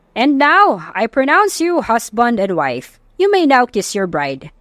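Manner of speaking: malakas na simula, mabagal na pagpapahayag ng pari; pari sa kasal. A narrator's reading. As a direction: ipahayag nang pormal at mabagal. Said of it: "Sambit ng pare". (pari na nagpapahayag sa bagong kasal, pormal)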